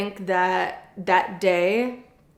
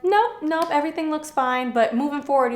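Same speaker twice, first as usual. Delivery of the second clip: breezy doctor; 8 semitones higher than usual. Retold or imitated. Doctor voiced, brushing off worry.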